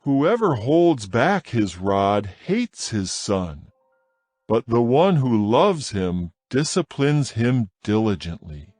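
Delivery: ordinary delivery, nothing marked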